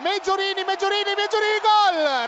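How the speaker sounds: rising goal shout, name repeated; breathless italian goal commentary. Real, not acted. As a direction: build to a roaring goal call. Goal call in live commentary.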